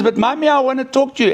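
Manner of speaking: put-on high pleading childlike voice; imitated little girl. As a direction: beg Mommy for attention like a toddler. Child pleading with her mother.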